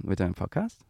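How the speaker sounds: "We're doing a podcast" ending upward on "podcast"; Australian rising intonation. Shown as a demonstration. Demonstrating the melody of speech back home, questioning upturn. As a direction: turn the statement into a question with a lift on "podcast". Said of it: "everything's a question".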